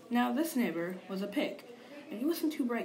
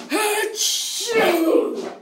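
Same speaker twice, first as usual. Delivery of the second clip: loud, high sneeze; big sneeze; 11 semitones higher, 13 dB louder than usual. Acted. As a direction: burst into a sneeze.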